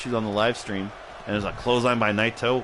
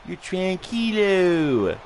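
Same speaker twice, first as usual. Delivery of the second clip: high, slow, drawn-out impression; John Travolta from Hairspray; 7 semitones higher, much slower than usual. Streamer imagining a Hairspray character reassuring them, high put-on voice. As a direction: croon it up high, stretching the last word. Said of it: "wanting John Travolta from Hairspray to tell me".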